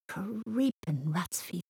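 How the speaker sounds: slow shocked whisper; whispered shock, slowly; hushed whisper. Acted. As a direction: breathe it out in shock. Shocked friend swearing.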